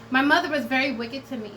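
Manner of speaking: usual speaking voice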